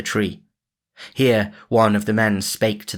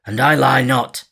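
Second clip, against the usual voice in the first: a hint of harsh Guy, louder; Guy of Gisborne's voice; 4 semitones higher, 5 dB louder than usual. A narrator's reading. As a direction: Robin keeping up the disguise. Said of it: "still in Guy of Gisborne's voice".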